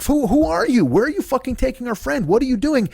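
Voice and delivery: high, alarmed questions; panicked pleading for a friend; frantic friends. Retold or imitated. Friends demanding answers as she is taken, high and alarmed.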